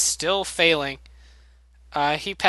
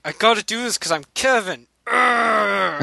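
higher, determined hammy grunt; mock heroic Kevin; 5 semitones higher than usual. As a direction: play the earnest hero then grunt. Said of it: "He's like".

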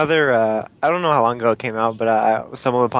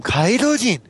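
mock shock; 8 semitones higher, slower than usual. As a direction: exclaim the name in pretend astonishment, pitch leaping up. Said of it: "they should be shocked every time".

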